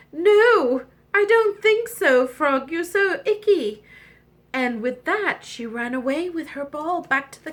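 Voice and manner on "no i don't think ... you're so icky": high, loud refusal; high-pitched princess snub; disgusted princess. Acted. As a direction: turn the frog down in disgust. Princess rejects icky frog.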